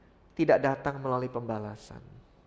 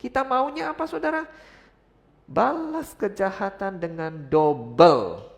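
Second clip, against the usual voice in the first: mock vengeful; 6 semitones higher, 10 dB louder than usual. Mocks the urge for revenge.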